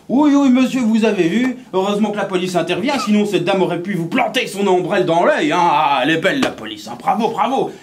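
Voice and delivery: high, loud gossiping crowd; high, loud mocking onlookers; heckling onlookers. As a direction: jeer like nosy bystanders. Onlookers comment on the scene.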